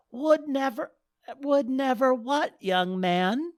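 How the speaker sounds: put-on an old lady poking fun at the groom; mocking old lady. Goddess mocks Dale's words.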